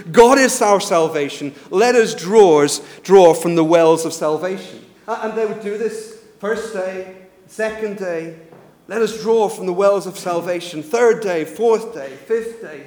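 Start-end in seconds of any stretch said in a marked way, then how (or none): 0.1-4.8 loud crowd response; shouting back. Retold or imitated. Temple crowd answering the priests, shouting back. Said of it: "the people would shout back as one". 8.9-10.8 loud ritual call. Retold or imitated. Priest calling on each feast day, calling out. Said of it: "they would call out".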